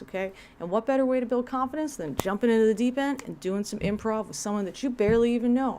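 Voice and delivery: rally the group into an exercise; pep-talk leader. Retold or imitated. The instructor pushing the class into improv, peppy and loud. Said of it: "He made them do improv".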